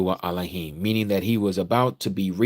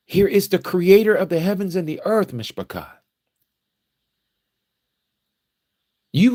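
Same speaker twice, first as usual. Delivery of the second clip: higher, louder, rising fervour; fervent preaching; 11 semitones higher, 5 dB louder than usual. Acted. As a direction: preach it out to the congregation with fire. Preaching rises to full force.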